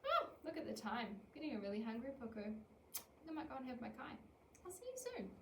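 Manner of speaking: light, breezy, unbothered goodbye. Shown as a demonstration. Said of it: "being breezy in their language".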